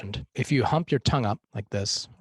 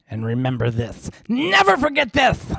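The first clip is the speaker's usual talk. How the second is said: high, loud sustained cry on "Never forget this"; priest impression; 11 semitones higher, 8 dB louder than usual. Coach quoting the priest, hammed up loud and high. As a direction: proclaim it like the pompous priest.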